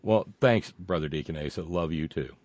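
ordinary delivery

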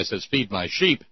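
usual speaking voice

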